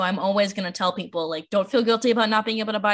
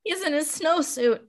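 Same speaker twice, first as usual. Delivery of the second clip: high, teary, overwrought voice; mock crying over a book; 8 semitones higher, 5 dB louder than usual. Librarian shows how she cries reading kids' books, teary high voice. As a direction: act out weeping at a children's book. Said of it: "I'll just be like".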